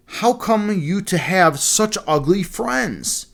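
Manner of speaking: lightly voiced prince, slow and appalled; exclaiming bridegroom. A narrator's reading. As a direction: drag it out like a shocked snob. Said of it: "the bridegroom could not help exclaiming".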